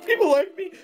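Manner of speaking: high-pitched hopeful squeak; pitiful Hank Pym. Acted. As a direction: ask it in a tiny hopeful falsetto. Acted pitiful Hank Pym reply.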